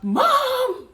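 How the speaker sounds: screeching, very high and loud mom imitation; excited mom. Brother tells mom, mom shrieks in excited panic. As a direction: ham up the panicking mom, laughing. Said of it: "he's making excitement".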